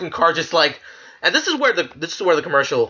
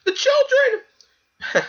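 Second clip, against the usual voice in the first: hammed-up wail, very high and slowly drawn out; mock wailing; much slower than usual. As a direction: cry "The children!" as a fake sobbing parent, high and stretched. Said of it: "being like".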